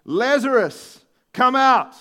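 calling out loud and high; loud shout. A narrator's reading. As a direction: shout it like a command into a tomb. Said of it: "Then Jesus shouted".

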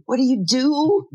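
high insistent rising question. Retold or imitated. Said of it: "everybody's asking him".

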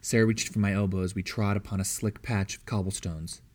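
ordinary delivery, nothing marked

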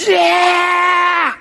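high, loud stadium cheer; heavenly crowd cheer. Retold or imitated. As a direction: shout it like a roaring crowd of witnesses. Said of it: "They're going".

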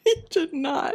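high, laughing protest; laughing disbelief. Real, not acted. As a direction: laugh out you did not. Friend announces she's single.

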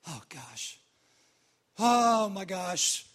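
long exasperated "oh my gosh"; disgusted Pharisee. Acted. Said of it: "what these Pharisees thought".

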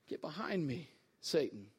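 very low, hushed and severe; low, soft, deadly serious reprimand; quiet rebuke. Acted. Jesus rebukes Peter.

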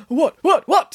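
high, startled sputter; startled waking Santa. A narrator's reading. Santa woken by nanny, startled sputter. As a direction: blurt it out as if jolted from sleep. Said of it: "woke up with a grunt".

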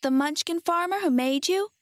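put-on high, questioning little girl's voice; guessing girl. A narrator's reading. Girl guesses what the Scarecrow fears, high and questioning.

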